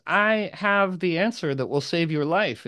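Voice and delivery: put-on a touch of the funky old crone; lightly voiced hag promising the answer; Dame Ragnall's voice. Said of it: "she rides up to Arthur and goes".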